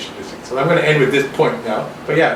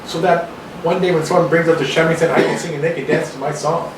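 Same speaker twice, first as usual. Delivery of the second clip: normal talking voice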